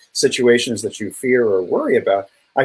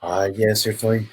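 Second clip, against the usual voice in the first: low, slow, soothing monotone; Deepak Chopra; 4 semitones lower, 5 dB quieter than usual. Retold or imitated. Podcast guest mimics a guru who puts her to sleep, low slow monotone. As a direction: imitate a sleep-inducing guru, pitched down and unhurried. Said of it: "he's got a bit of a monotone to him".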